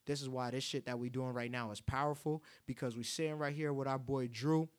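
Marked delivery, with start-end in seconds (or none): none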